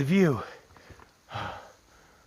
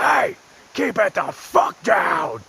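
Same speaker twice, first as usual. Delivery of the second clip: Batman voice; 3 semitones higher, 6 dB louder, much slower than usual. Retold or imitated. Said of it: "come at you with Batman voice".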